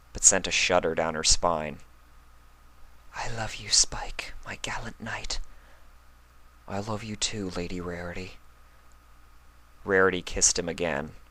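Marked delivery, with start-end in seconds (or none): 3.2-5.4 whispered I love you, much softer; tender whisper. Acted. Romantic confession after kiss. 6.7-8.4 soft reply. Acted. Spike answers tenderly.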